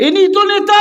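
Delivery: very high-pitched ringing call; rousing cry. Real, not acted. Repeats it louder to rouse crowd.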